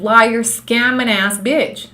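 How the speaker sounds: each word dragged out slowly; slow, spat insult. Real, not acted. Calling Candace a liar to her viewers, slow and spat. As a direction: drag out every word of the insult. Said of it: "spelling out her opinion of Candace".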